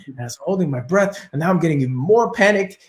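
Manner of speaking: usual speaking voice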